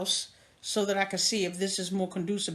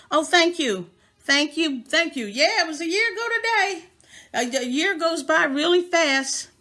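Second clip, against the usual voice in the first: excited thanks; 7 semitones higher, 6 dB louder than usual. Real, not acted. Thanking viewers, raised voice.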